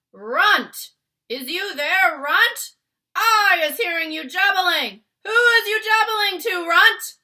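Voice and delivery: a giant's booming, high, very loud shout; thunderous shout. Acted. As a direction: roar it like thunder down at the cave. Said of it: "a voice like thunder shouted".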